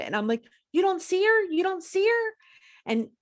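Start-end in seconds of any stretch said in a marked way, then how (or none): 0.7-2.3 very high-pitched retold disbelief; incredulous. Patient asking her husband about seeing Laura, incredulous. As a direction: ask it in squeaky astonishment.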